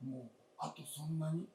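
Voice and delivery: ordinary talk, nothing marked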